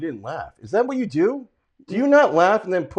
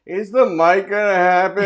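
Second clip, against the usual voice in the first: higher, drawn-out mimicry; mocking text quote; 5 semitones higher than usual. Broken-down driver quoting an ill-timed text, mocking. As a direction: imitate the message with slow, raised pitch.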